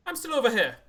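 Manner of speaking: put-on loud, high Irish-accented line. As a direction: blurt it in a hammed-up Irish accent. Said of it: "I gave him Jim Jar's accent there".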